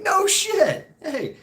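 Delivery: very high, loud disbelief; amazed reaction. Retold or imitated. Friend reacting to the family connection, high-pitched and amazed. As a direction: exclaim it in acted amazement.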